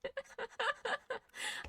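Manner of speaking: giggle. Real, not acted. Quiet high laugh.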